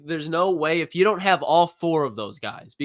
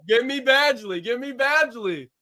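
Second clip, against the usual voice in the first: higher, insistent repeat of the demand; emphatic insistence; 7 semitones higher than usual. Retold or imitated. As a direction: hammer home the pick, higher each time. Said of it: "I said".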